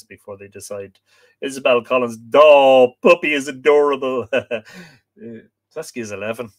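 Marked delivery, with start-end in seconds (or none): 2.3-4.4 gushing. Retold or imitated. Reads out viewer's gushing comment.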